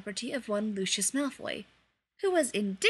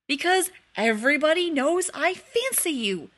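loud, higher bellowed confession; bellowing; 6 semitones higher, 8 dB louder than usual. A narrator's reading. Sirius bellows his confession at Remus in the bath. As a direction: roar the confession out. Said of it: "Sirius bellowed".

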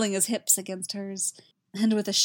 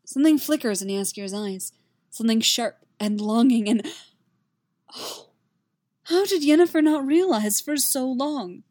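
ordinary delivery

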